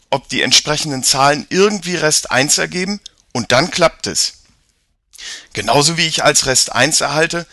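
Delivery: put-on an eager boy explaining; boy character voice. Jakob explains the systems.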